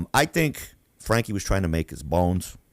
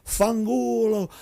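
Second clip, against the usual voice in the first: mock screaming curse; 12 semitones higher, slower than usual. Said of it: "he's still screaming".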